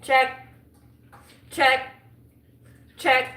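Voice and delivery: high, loud, punchy one-word call; called-out checks. Pretend. Doodler ticking boxes to feel accomplished, triumphant loud calls. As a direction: call out each tick loudly and high. Calling out boxes being ticked off.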